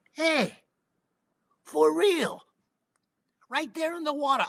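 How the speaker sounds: high, loud burst of amazement; high-pitched excited for real; amazed exclaim. Retold or imitated. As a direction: blurt it out like you can't believe your eyes. Amazed at finding poi pounder.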